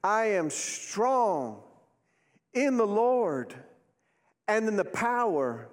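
very slow, stretched phrases, pauses between; leading a declaration. Shown as a demonstration. Preacher calls congregation to say it now, slow declaration. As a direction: draw out each phrase for the crowd. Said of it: "Say it with me now".

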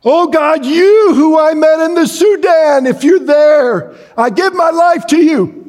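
high, loud desperate cry to God. Retold or imitated. Man facing execution cries out to God, high and loud. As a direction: shout your last prayer before the gun fires. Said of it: "he cried out".

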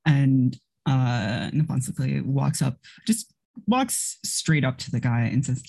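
ordinary delivery

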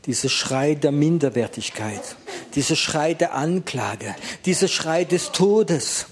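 rising from calm into firm declaration; building prayer. Acted. Pastor naming the cries he will break, building intensity.

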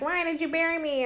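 a hint of a doll asking why it was buried; mock doll voice. Detective speaking through the doll to Jamie, lightly mocking doll voice. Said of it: "uses the doll to, like, ask questions".

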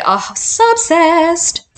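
slow, high gush; swooning fangirl drawl. Real, not acted. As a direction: stretch it out like a smitten fan. Remembering childhood obsession.

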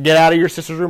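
retold dad's yell. Dad yells from three rooms away, imitated shout. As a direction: imitate a father yelling from afar. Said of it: "my dad would just yell my name".

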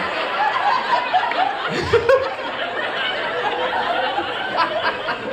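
silent wheezing laugh; breathless high laugh. Real, not acted. Cracking up at his own prank story, a breathy wheeze.

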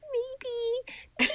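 high, coy put-on squeak; coy giggly. Replying to his offer of a kiss, coy and giggly. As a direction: answer coyly through a high giggle.

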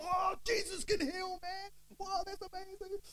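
very high, drawn-out amazed holler; hammed-up street celebration, stretching the words; jubilant shouting.

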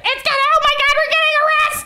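high, laughing alarm; mock panic. Comic hiding from the neighbor, high mock panic. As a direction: squeal that you're about to be arrested. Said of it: "I was like".

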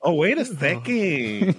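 sassy. Acted. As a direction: draw it out with mock sassy attitude. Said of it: "you just gotta get sassy with it".

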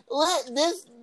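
high-pitched mocking impression, breaking into laughter; mock a spokeswoman in a high put-on voice; Kaylee McAfee. Host parodying a press secretary denying defeat, high mocking voice. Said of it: "Kaylee McAfee getting on the screen".

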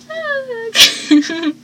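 high, drawn-out "ah"; imitated little cry. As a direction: mimic a small high-pitched yelp. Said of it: "She goes".

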